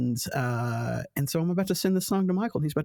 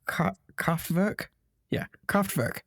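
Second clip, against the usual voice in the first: German pronunciation; 2 semitones higher, slower than usual. Shown as a demonstration. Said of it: "the R's are kind of soft, so it's like".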